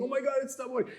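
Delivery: high-pitched mock-crying awe; imitated tearful fan. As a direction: gush like an overcome elderly fan. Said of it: "eighty-year-old women crying".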